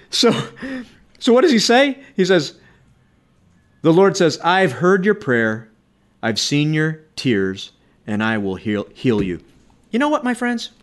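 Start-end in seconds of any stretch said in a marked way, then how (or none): 4.4-9.4 slow quote, sinking lower toward the end; measured and deliberate, settling down low; solemn divine promise. Acted. Preacher repeating the Lord's answer to prayer, slow and solemn.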